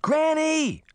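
calling out loud and high; desperate shout for help. A narrator's reading. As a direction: yell for Granny in panic. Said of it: "Sabrina shouted".

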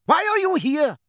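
high, angry centaur shout; forceful high yell at a trespasser; bellowed demand. A narrator's reading. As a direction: bellow the question, voice leaping up. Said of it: "bellowed the hard-faced grey centaur".

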